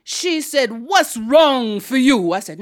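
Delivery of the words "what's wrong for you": slow, loud island-aunt demand; Aunt Queenie. Acted. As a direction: demand it slowly as a stern aunt. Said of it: "deep voice".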